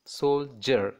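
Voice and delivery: "soldier" as sol, gap, jer; two separate beats in "soldier"; syllable by syllable. Shown as a demonstration. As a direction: break "soldier" after the L. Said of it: "that is two syllables".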